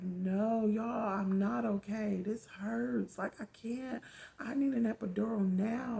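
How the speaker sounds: loud, high desperate pleading; yelling in pain. Retold or imitated. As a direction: demand the epidural through the pain. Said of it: "I'm yelling loud".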